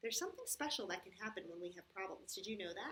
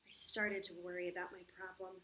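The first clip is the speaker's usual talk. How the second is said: put-on storybook narration for children; story reading. A narrator's reading. Teacher reads the problem story to the kids, storybook voice. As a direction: read it like a picture book aloud.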